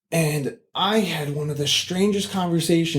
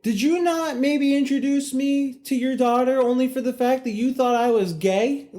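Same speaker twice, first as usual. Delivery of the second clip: incredulous; 10 semitones higher than usual. Retold or imitated. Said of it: "And I'm just like".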